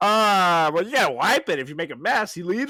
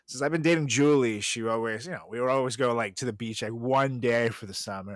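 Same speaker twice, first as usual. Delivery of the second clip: normal talking voice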